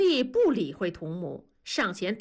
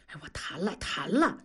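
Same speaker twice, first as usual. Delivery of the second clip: weak, whispery husband's reply; hushed protest; 3 semitones lower, 4 dB quieter than usual. A narrator's reading. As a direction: protest feebly under your breath. 丈夫挨骂后辩解. (丈夫压低声音无力辩解)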